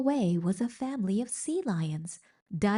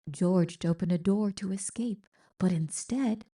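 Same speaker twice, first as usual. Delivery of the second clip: ordinary delivery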